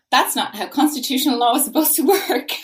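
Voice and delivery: 웃음이 섞인 짜증 목소리; exasperated laugh. Real, not acted. Frustrated about constitutional law.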